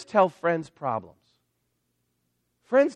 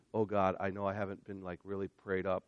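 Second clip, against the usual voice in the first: mock timid prayer; 11 semitones lower, 12 dB quieter than usual. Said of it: "We're like".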